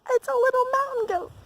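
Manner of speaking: very high, tiny delighted voice; high-pitched cutesy excitement; delighted squeal. Real, not acted. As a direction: coo over a cute animal sighting. Spotting an animal on the walk.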